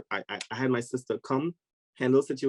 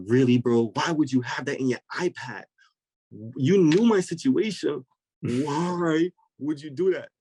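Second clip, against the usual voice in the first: exasperated reproach, louder and higher; drawn-out, pleading "why" to a friend; retold indignant outburst, slowing for emphasis; upset; 3 semitones higher, 4 dB louder than usual. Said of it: "So I was really upset".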